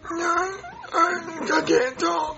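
weak, strained attempt at speech; voice gone. Kid tries talking for the doctor, voice gone. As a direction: force out words with no voice left. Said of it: "he's making that noise when he tries to talk".

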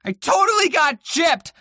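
outraged complaint about being cheated; indignant shout. Real, not acted. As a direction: yell that you got cheated. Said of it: "I was so sad".

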